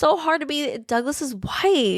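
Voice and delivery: high-pitched acted-out pleading. Wendy apologizing to Millie to save herself, pleading. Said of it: "trying to reason with her".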